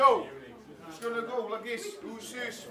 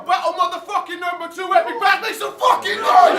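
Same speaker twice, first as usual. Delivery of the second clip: hype MC shout; 3 semitones higher, 6 dB louder than usual. Real, not acted. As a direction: build to a roar for the crowd. MC hyping the battle crowd.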